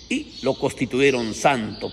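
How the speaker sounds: ordinary delivery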